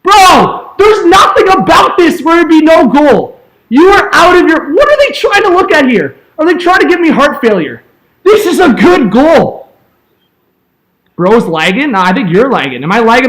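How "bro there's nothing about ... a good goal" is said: loud, higher, exasperated pleading with the refs; outraged protest. Real, not acted. Fan protesting the goal review, outraged and loud. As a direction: bark it at the screen in disbelief.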